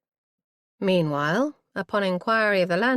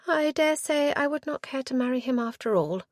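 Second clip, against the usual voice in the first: airy; 8 semitones higher than usual. A narrator's reading. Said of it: "said in an airy fashion".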